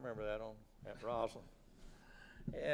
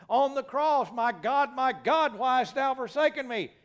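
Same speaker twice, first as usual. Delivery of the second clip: high, loud anguished cry to God; full-force preaching; 12 semitones higher, 16 dB louder than usual. Acted. Preacher as Jesus on the cross, loud impassioned cry. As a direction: cry it out from the pulpit.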